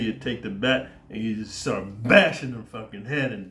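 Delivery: ordinary delivery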